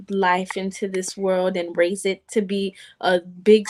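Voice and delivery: normal talking voice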